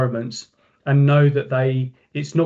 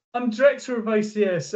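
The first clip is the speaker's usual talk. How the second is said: raised-pitch mock shout; retold yell to the sound engineer; imitating shouting; 8 semitones higher than usual. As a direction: announce yourself over club noise, not at full volume. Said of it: "we were shouting at each other".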